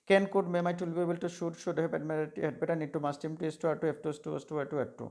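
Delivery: rapid-fire recitation. Shown as a demonstration. Teacher demonstrating how to rattle off the list. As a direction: reel off the modal list quickly in one breath. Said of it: "এইরকম করে শিখবে তোমরা".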